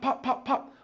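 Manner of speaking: quick high popping mouth-noise gunshots, laughing; imitated gunshots. Telling a friend about gunfire near the office, mock gunshot pops. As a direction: pop it out like distant gunfire, half laughing.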